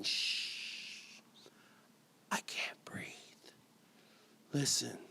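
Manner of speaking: hushed whisper after a shush; a secret breathed out. Acted. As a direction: drop to an almost silent whisper. Poem hushes the refrain.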